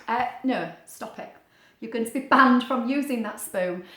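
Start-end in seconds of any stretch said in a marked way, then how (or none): none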